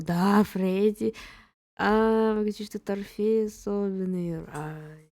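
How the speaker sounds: ordinary delivery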